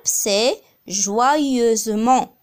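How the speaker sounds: joyeusement drawn out slowly; slow demo. Shown as a demonstration. Teacher modelling the adverb, very slow. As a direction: stretch every syllable for the class. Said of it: "Alors l'adverbe".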